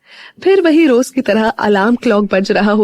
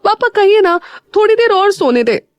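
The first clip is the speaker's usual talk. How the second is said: much higher whiny plea through a pillow; muffled pleading; 11 semitones higher than usual. A narrator's reading. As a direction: beg for more sleep, face in pillow. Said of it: "मुंह पर तकिया रखते हुए बोली". (तकिए में मुँह दबाकर, ऊँची मिन्नत)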